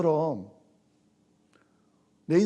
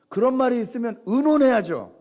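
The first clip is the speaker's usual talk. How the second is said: emphatic; 6 semitones higher, 4 dB louder than usual. Shown as a demonstration. First statement of must discuss.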